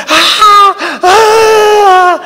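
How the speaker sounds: high mock shrieks; hammed-up crowd shriek; fan screams. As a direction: scream like fans at the railings. Fans screaming from railings.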